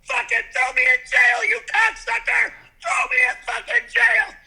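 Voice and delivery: high, loud, raging yells; furious shouting. Pretend. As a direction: scream insults at the cop. Yelling at the pretend officer.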